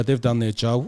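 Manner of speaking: normal talking voice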